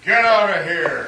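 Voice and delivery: shouted get out; angry warning. Acted. Islander warns the visitors off his beach, angry shout. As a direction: drive the strangers off. Armed man warning off strangers.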